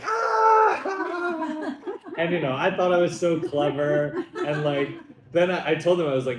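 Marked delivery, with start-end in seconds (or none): 0.0-1.8 high, loud whooping "Ah!"; crowd's burst of laughter. Retold or imitated. Comic mimicking Irish crowd hearing the punchline, shrieking laugh. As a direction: imitate an audience erupting at a punchline. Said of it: "And they were like".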